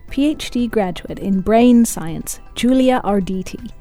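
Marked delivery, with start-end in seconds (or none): none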